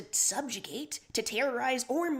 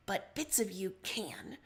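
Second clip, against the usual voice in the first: ordinary delivery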